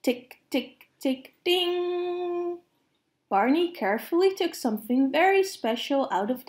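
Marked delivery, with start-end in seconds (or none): 0.0-2.6 soft ticks, then ding jumps up high; oven timer sound. Retold or imitated. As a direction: tick quietly like a kitchen timer, pop up on ding. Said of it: "the oven timer went".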